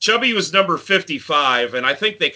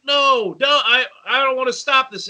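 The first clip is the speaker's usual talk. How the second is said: high, strained imitated panic; frantic friend; 9 semitones higher than usual. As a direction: cry out in a put-on panicky voice. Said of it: "And he's like".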